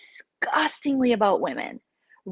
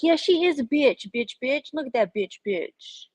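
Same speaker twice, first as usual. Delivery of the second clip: louder jeering mock chant; lightly hammed-up gossip jeer; mocking panelist; 5 semitones higher, 3 dB louder than usual. Imitating the panel piling on, mock jeer. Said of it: "and then it's like".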